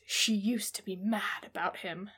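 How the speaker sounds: dramatic breathy tagline whisper. Shown as a demonstration. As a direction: read the tagline like a movie trailer whisper. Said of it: "the tagline is".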